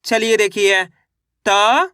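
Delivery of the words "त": "त" held alone, rising; letter by letter. Shown as a demonstration. Teacher shows the method once more, drawn-out rising "त". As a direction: sound out "त" as one long letter. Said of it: "किस तरीके से एक अक्षर का उच्चारण".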